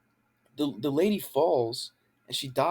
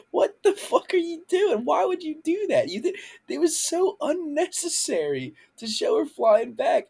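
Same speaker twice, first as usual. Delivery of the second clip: exasperated rant. Real, not acted. Ranting at the show.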